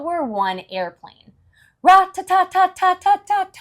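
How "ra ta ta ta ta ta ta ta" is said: high, loud rat-a-tat gunfire; machine gun noise. Acted.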